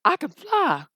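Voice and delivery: high, loud excited donkey voice. Acted. Donkey discovers he can fly, high and excited. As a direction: crow it in a high put-on voice. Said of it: "this man named Donkey over here was like".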